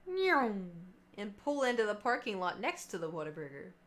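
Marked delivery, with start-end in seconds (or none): 0.1-0.5 high mock police siren wail; playful siren noise; siren imitation. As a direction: imitate a cop car siren with your voice. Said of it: "I see a cop car go".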